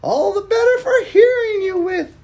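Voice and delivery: high, loud wolf-granny voice; toothy grin. Acted. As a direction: grin while you say it. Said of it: "with a toothy grin".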